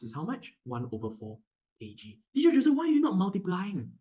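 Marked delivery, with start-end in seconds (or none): 2.3-3.9 high, loud mock student question; imitated protesting student. Teacher playing a student querying the method, high and loud. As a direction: challenge the teacher in a raised pupil voice.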